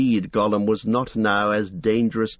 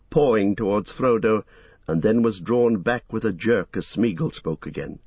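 ordinary delivery

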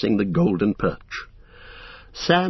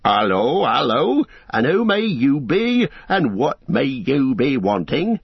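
country farmer sizing up strangers, rising questions; drawn-out, wary farmer's greeting; challenging hobbit farmer; 4 semitones higher than usual. Acted. Farmer confronts strangers at his gate, challenging.

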